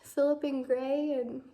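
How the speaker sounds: real tearful tightness, pitch up high; holding back tears, voice straining upward; emotional. Real, not acted. Granddaughter telling grandpa she loves him, choked up. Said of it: "I'm probably just emotional because I'm pregnant".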